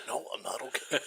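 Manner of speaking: soft, laughing groan; laughing through it. Retold or imitated. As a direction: let the laugh break the moan. Repeated while laughing.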